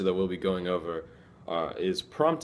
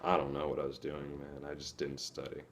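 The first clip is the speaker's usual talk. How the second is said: softer, trailing off in defeat; dejected; 3 semitones lower, 8 dB quieter than usual. Shown as a demonstration. Student who failed a test mutters dejectedly. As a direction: sigh it out like you failed.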